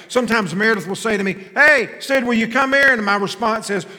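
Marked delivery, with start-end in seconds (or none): 1.5-2.9 high, loud call across the house; wife calling. Retold or imitated. Husband imitating his wife calling him, high and loud.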